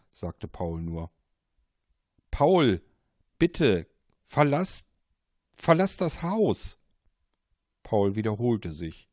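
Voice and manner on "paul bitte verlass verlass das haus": much higher, louder pleading command; desperate caller, near shouting; urgent warning. Acted. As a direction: drive him out of the house. Caller begs Paul.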